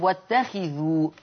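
short "i" after the kha in "واتخذوا"; kasra on kha. Shown as a demonstration. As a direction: say "واتخذوا" slowly as wattakhidhu. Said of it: "حفص بيقرأ بكسر الخاء".

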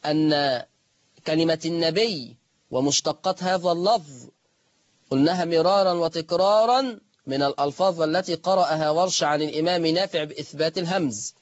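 usual speaking voice

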